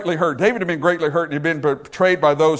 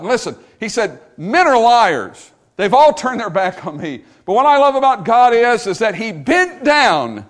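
preaching at full force; 4 semitones higher, 4 dB louder than usual. Acted. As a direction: build the sermon to a fervent peak. Preacher builds to a climax.

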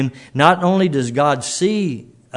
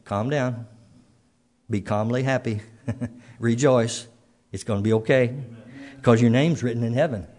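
softer, gentle reassurance; calm, soothing Lord; 2 semitones lower, 4 dB quieter than usual. Pretend. Preacher voicing the Lord calming a troubled listener, softly reassuring. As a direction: soothe the anxious soul quietly. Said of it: "the Lord comes along and says".

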